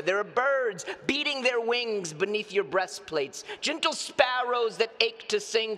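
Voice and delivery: impassioned stage poem delivery; poem recitation. Acted. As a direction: recite verse in long, driving runs with stretched key words.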